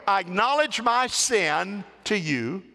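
slow, solemn reading of a psalm; scripture reading. Acted. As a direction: read it slow and weighty. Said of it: "Here we go".

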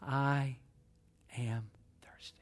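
slow, halting, fading to a whisper; dying words. Acted. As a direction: let the last words sink low into a whisper. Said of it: "said in his last moments".

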